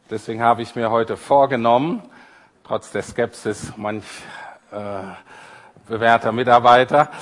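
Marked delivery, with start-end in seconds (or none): none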